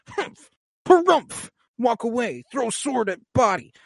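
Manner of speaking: high, hammed-up grumpy teen harrumph, slow at first; imitating a sulky kid who flounces away; mock sulky huff. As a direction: play a pouting teen storming off, high and huffy. Mocking sulky Jon's reaction.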